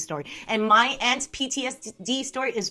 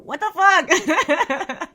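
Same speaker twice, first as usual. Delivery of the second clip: loud, high, drawn-out outburst breaking into laughter; laughing disbelief; 7 semitones higher, 7 dB louder, much slower than usual. Real, not acted. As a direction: exclaim in amused outrage. Mock outrage at chat message.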